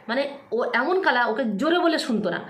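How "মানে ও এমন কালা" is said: ordinary talk, nothing marked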